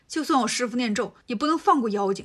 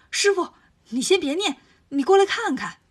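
urgent call; 5 semitones higher, 5 dB louder than usual. Acted. Monkey urgently stops Tang Seng.

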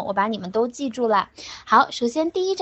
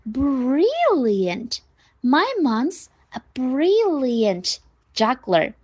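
"brilliant" drawn out very slowly; slow model line, long swooping "brilliant"; exaggerated stress; much slower than usual. Shown as a demonstration. Teacher modelling praise of mom's juggling, exaggerated stress. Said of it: "发夸张以后".

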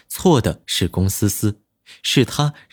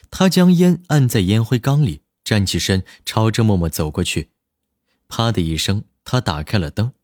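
ordinary delivery

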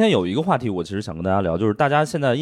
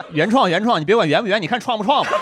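high, laughing wordplay; laughing teasing; 10 semitones higher than usual. Pretend. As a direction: tease through laughter. Teasing with a pun.

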